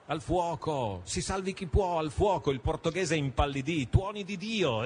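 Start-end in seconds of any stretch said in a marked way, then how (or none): 0.1-2.5 raised, higher cries of fire; mild mock shouts of fire; alarmed shouting. A narrator's reading. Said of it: "si udirono alcune voci gridare". 3.9-4.8 a sudden frightened curse; startled oath. A narrator's reading. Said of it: "esclamò".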